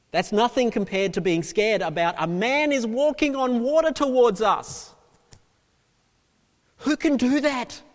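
climbing, loud, high-pitched alarm; alarmed preaching. Acted. Preacher voicing scared disciples on the lake, alarmed. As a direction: build to an astonished cry.

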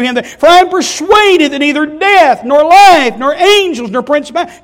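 loud, high impassioned proclaiming; preaching at full force. Acted. Preacher to congregation, loud impassioned psalm. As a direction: cry out the psalm's promise.

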